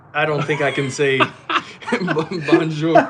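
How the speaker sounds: breaking into laughter mid-sentence; can't get through bonjour for laughing; giggling through a failed attempt at bonjour. Real, not acted. Tries a southern-accent bonjour.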